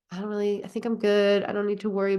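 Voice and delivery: normal talking voice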